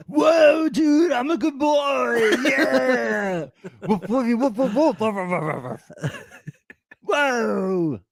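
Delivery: high barking dog voice; playing a dog. [name] pretending to be a happy dog, high barks. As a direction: bark like an excited puppy. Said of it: "[name] wags his tail".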